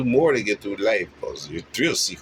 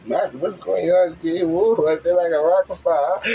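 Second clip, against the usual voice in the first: higher, louder, awed delight; amazed rock star; 8 semitones higher, 4 dB louder than usual. Retold or imitated. Someone stepping into bliss, awed and loud. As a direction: gush like you just hit paradise. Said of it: "You be like".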